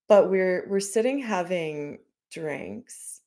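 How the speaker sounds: normal talking voice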